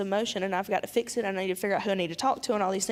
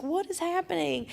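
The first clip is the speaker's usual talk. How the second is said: much higher, startled mock disbelief; retold shock; 9 semitones higher than usual. Walking into the couple's argument, higher startled disbelief. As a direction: gasp it out, thrown by the scene. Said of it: "I was like".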